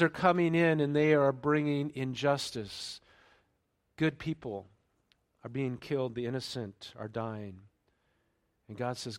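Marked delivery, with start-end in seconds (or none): none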